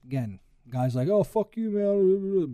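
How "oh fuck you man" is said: higher, cocky put-on tough-guy sneer; mock tough guy. Suspect mouthing off at the cop, mocking tough-guy sneer. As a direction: play the hard man mouthing off. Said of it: "being all hard and tough like a cool guy".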